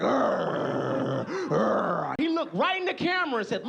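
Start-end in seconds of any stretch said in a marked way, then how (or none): 0.0-2.1 low rolling bear grumble; imitated bear growl. Bear answers the bear man, growling. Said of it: "The bear was like".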